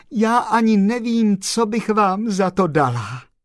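very high, loud gush of gratitude; overjoyed. A narrator's reading. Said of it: "oči se jí zalily slzami radosti". (nadšeně děkovat vysokým hlasem)

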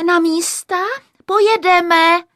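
high, loud set call; assistant director's call. A narrator's reading. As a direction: call the crew to their places. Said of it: "zatleskala asistentka režie". (hlasité zvolání asistentky)

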